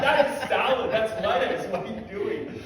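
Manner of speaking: can't believe what she's cooking; incredulous. Retold or imitated. Watching her stir fry salad, incredulous.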